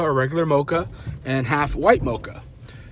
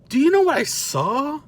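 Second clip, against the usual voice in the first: high-pitched comic "Do you know what I saw?"; mounting disbelief; 10 semitones higher than usual. Real, not acted. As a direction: send the question way up for the big reveal. Third ask, building to the reveal.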